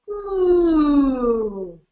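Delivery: long falling siren "ooh"; low descending ooh. Shown as a demonstration. Presenter showing a vocal warm-up, low ooh. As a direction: slide slowly down to the bottom of the range. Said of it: "going as low as you possibly can".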